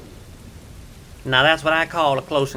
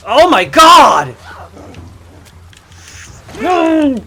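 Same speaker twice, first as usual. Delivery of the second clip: shrieking much higher and louder; frightened yell; 13 semitones higher, 15 dB louder, much slower than usual. Real, not acted. Jump scare in horror game.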